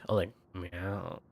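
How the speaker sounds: soft, slow, smug meow. Retold or imitated. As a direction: make the meow sound smug. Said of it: "it sounded kind of smug".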